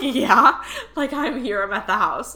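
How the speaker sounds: high, loud, emphatic yes; retold excited confirmation. Client telling medium she's at the house, excited.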